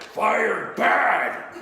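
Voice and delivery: loud monster outburst; Frankenstein. Acted. Monster just fired by his boss, loud lumbering bellow. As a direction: bellow it like an upset zombie. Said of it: "slow, limbering zombie".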